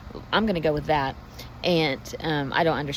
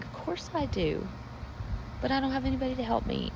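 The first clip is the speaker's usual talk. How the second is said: the lame man's reply; 9 semitones higher than usual. Acted. The man answers Jesus.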